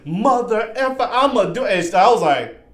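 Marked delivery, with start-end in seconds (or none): 0.0-1.6 high-pitched mock demon rant; high, abusive demon imitation; cussing demon. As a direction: imitate a demon cursing someone out. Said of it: "cussing at him".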